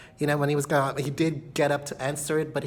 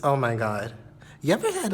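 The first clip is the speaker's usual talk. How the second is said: ordinary talk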